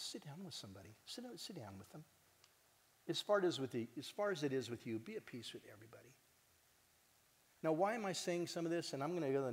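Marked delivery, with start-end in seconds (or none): 0.0-2.0 much softer, gentle insistence; soft urging. Real, not acted. Pastor softly urging the crowd to talk face to face. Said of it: "urges sitting down". 5.0-6.1 soft blessing. Real, not acted. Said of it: "closing exhortation".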